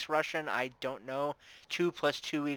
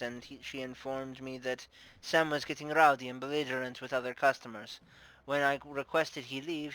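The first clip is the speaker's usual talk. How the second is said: put-on formal, Russian-accented witness account. Bar owner recounting the night of his death, Russian accent. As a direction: give testimony as a Russian-accented madam. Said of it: "I'm doing a Russian accent".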